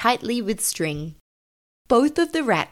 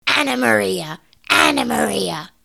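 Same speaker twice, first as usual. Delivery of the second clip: louder calling rat voice; 5 dB louder than usual. A narrator's reading. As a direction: call her name across the room. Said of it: "called the large man rat".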